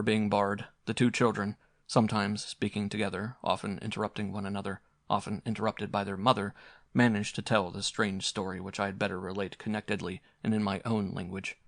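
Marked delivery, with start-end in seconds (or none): none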